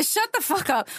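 high-pitched telling-off of a teasing friend; playful exasperation. Real, not acted. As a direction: cut the heckler off, pitch jumping up. Snapping at teasing co-host mid-story.

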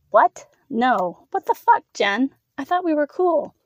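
alarmed, pleading objection; shocked protest. Acted. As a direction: protest in startled disbelief. Victim protests at sudden threat.

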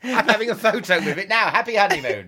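high, loud, put-on gushing; hammed-up enthusiasm to embarrass a partner; mock delight. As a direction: overdo the love for an awful room. Acts gushing over the bad room.

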